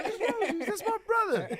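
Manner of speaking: high, loud quoted voice; retold outburst. Reacting to a brother revelation, high imitated outburst. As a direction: act out the reply in a loud high pitch. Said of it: "Yo, he said".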